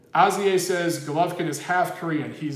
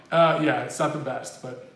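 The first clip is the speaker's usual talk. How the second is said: ordinary talk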